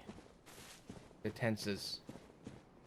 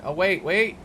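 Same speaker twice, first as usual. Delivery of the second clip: high, loud burst of recognition; light up loudly with sudden hope; sudden excitement; 9 semitones higher, 4 dB louder than usual. Real, not acted. Player recognises where the souls were lost, loud and excited.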